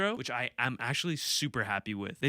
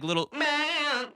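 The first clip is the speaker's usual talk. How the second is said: imitating [name]; 14 semitones higher, 3 dB louder, slower than usual. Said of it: "She has these, like".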